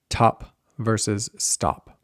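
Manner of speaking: slow contrast of aspirated Top and unaspirated stop; aspiration contrast. Shown as a demonstration. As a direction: burst of air on Top, little on stop. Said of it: "not nearly as aspirated".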